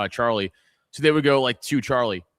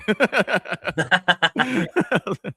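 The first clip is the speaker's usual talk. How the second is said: long high laugh; 8 semitones higher, 3 dB louder than usual. Real, not acted. Laughs after the joke.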